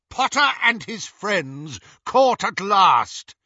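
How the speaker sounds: high, loud, drawn-out triumph; triumphant cry. A narrator's reading. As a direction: savour the victory, loud and slow. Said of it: "cried Lucius".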